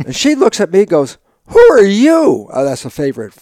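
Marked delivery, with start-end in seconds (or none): 1.5-2.5 high, loud, slow stunned who are you; shocked. Retold or imitated. Wife reacting to her husband's sudden rudeness, stunned and loud.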